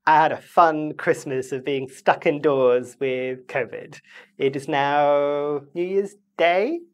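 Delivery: usual speaking voice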